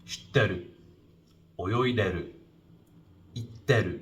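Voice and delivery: "shitteru" with the i dropped; contracted form. Shown as a demonstration. Teacher showing natural Tokyo contraction, dropping the i. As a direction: clip the i out of "itteru".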